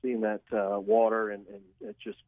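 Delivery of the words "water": ordinary talk, nothing marked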